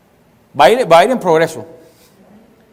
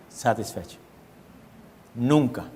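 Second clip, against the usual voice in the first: drops lower and much softer on satisfecho and nunca; hushed emphasis; 7 semitones lower, 10 dB quieter than usual. Acted. Preacher warning the congregation, sinking to a hush. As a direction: let the final words fall to a grave hush.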